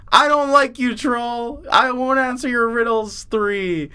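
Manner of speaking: high, mock-bold challenge to a troll; defiant hero. Teller acting the listener defying the troll, mock-defiant. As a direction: pitch it up like a storybook hero refusing. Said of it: "you looked at the troll and you said".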